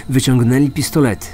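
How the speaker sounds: ordinary talk, nothing marked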